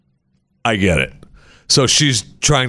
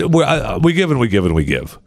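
normal talking voice

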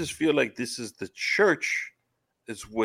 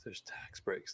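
whispered aside; 4 semitones lower, 17 dB quieter, slower than usual. Retold or imitated. Aside about church perks.

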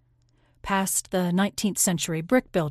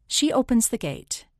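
ordinary delivery, nothing marked

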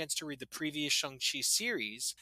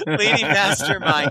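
laughing; 5 semitones higher, 13 dB louder than usual. Real, not acted. Cracking up mid-sentence.

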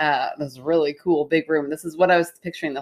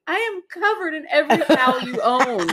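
much higher, hammed-up outrage; mock exasperated; 9 semitones higher than usual. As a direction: play up the indignation. Retold morning argument.

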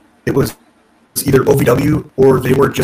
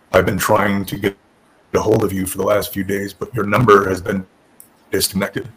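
Tommy Dreamer; 4 semitones lower than usual. Retold or imitated. Boss explaining a failed call to job hopeful, monotone. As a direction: drone it out as an imitated deadpan boss. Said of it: "This super monotone voice".

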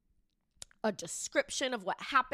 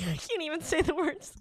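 laughing; 6 semitones higher than usual. Real, not acted. Too embarrassed to go on.

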